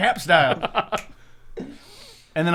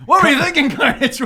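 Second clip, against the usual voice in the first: higher, louder mock scolding; mocking echo; 8 semitones higher, 3 dB louder than usual. Player echoing a friend's line to scold Carnage, mocking. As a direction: toss the question back teasingly.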